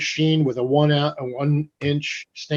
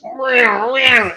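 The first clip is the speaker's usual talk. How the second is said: high, louder mock machine whirr; machine whine; 10 semitones higher, 5 dB louder than usual. Telling the host how the rotary ran, high machine imitation. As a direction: make the tattoo machine's whine with your voice.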